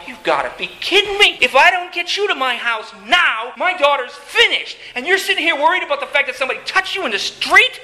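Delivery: high-pitched, incredulous outburst; flipping out. Acted. Jairus inwardly raging at Jesus for the delay, flipping out. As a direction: act out losing it in disbelief. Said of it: "he is flipping out".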